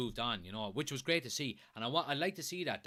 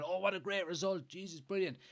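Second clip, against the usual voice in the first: higher-pitched mock gushing; imitating delighted fans; 8 semitones higher than usual. Streamer mimicking fans and media after a win, mock delighted. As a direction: gush like an easily pleased fan. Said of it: "everyone was positive about it".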